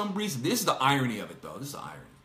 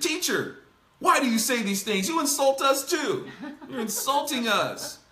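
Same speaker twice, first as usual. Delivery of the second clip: whiny Pharisee; 6 semitones higher than usual. Retold or imitated. Pharisee expert protests to Jesus.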